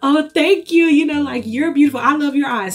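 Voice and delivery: high, loud nervous gush; flustered gushing. Retold or imitated. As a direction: babble thanks back in a fluster. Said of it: "I was flustered".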